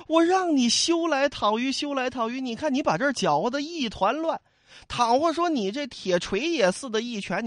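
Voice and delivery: high, exasperated scolding; scolding Dai Zong. Acted. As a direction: nag him like an older brother. (戴宗高声埋怨李逵)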